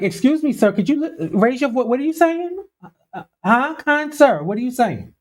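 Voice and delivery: high-pitched, exaggeratedly polite confusion; mock puzzled. Host asking the mumbling official to speak up, mock puzzled. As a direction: play a listener struggling to make out the words. Acts out straining to hear him.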